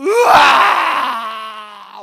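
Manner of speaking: a long loud crying wail from the belly. Retold or imitated. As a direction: wail it out as the tears burst. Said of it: "막 울음이 터져서".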